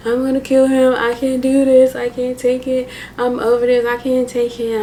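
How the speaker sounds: crying breakdown. Retold or imitated. Standing over sleeping boyfriend with gun, sobbing and desperate. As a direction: cry it out like you're at the edge. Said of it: "like, crying".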